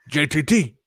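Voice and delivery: a hint of a cartoon teen voice; Beavis. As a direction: lightly imitate a friend's cartoon voice. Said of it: "who talked like Beavis forever".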